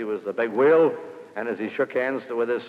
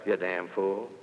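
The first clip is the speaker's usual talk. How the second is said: low, soft aside insult; under my breath; 6 semitones lower, 7 dB quieter, slower than usual. Retold or imitated. Quietly cursing the smoking salesman under his breath. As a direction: mutter it so the salesman can't hear. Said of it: "And then under my breath".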